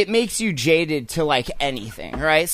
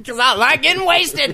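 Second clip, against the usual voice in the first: laughing shout; 9 semitones higher, 4 dB louder than usual. Pretend. Mocking the drinker's excuse.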